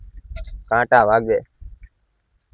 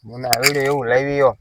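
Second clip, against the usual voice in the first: mocking echo; 5 semitones lower, 6 dB quieter than usual. Mimics the other's excuse.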